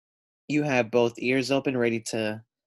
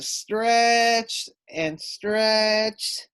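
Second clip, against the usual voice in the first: very slow, high, louder drawn-out reading; slow-motion straining narration; stretched out; 8 semitones higher, 4 dB louder, much slower than usual. A narrator's reading. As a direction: draw it out like reaching as far as you can. Sophia straining on climbing wall.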